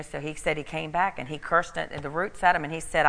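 usual speaking voice

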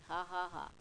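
measured, deliberate ha-ha laugh; mock laugh, spelled out in ha-has; imitated laughing. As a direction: act out laughing in short, separate bursts. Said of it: "lay there in bed and start laughing".

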